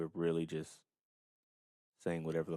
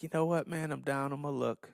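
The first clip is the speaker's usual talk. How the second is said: pitched-up voice of a down-on-his-luck hustler; mock hustler voice; 8 semitones higher, 5 dB louder than usual. Retelling his weed-selling days, higher mock hustler voice. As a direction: lightly play a broke young guy pitching a sale. Acting out his younger dealer self.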